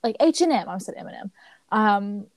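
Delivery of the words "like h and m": high, indignant diva voice; huffy imitated drag host. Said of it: "[name] was like".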